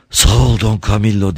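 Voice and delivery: ordinary delivery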